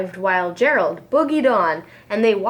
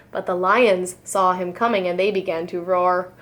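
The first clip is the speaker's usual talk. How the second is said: usual speaking voice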